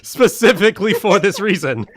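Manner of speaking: mock-furious, laughing through it; laughing outrage. Real, not acted. Player laughing at friends who forgot the bonus, mock outrage. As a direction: yell at the table, half laughing.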